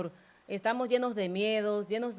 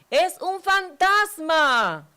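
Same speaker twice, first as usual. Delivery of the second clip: high, loud, slow cry of terror; frightened shout; 8 semitones higher, 9 dB louder, much slower than usual. A narrator's reading. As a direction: cry out as if seeing a ghost. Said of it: "y gritaban de miedo".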